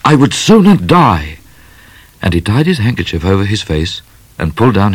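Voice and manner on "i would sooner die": higher-pitched heroic refusal; vehement cry. A narrator's reading. Midnight refusing to shoot the lady, vehement cry. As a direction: declare it vehemently, voice raised. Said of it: "cried Midnight, jumping up".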